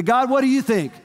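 high-pitched, eager mock question; eager asking. Believer asking God about his Son, eagerly. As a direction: call the question upward, pitch lifted high.

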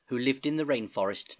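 ordinary delivery, nothing marked

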